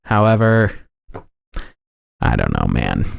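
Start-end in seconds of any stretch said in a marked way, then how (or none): 2.2-3.1 deep comic voice. Acted. Deep aside voice.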